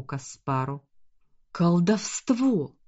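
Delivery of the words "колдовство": slow drawn-out cry of alarm; wounded man crying out; man down shout. A narrator's reading. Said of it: "закричал раненый капитан Варцис".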